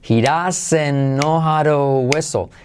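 "He doesn't know how to whistle" stretched slowly but linked; slow linked model. Shown as a demonstration. («He doesn't know how to whistle» lento y enlazado)

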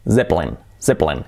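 "Zeppelin" as ZEP-lin, pitched low; English "Zeppelin" in two syllables, buzzing Z; middle E omitted. Shown as a demonstration. Teacher showing the nearly omitted middle E, English pronunciation. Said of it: "casi se omite".

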